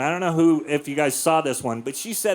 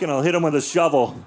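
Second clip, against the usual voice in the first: ordinary delivery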